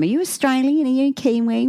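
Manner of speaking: questioning foreigner. Retold or imitated. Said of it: "from foreigners".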